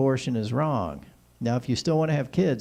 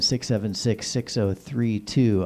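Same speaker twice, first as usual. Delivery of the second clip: normal talking voice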